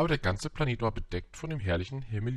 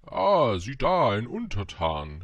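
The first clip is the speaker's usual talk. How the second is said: loud, high royal exclamation tapering down; pompous storybook king's cry; king calling out; 3 semitones higher, 8 dB louder, slower than usual. A narrator's reading. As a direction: hail a subject loudly, drawing out the last word. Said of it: "rief der König".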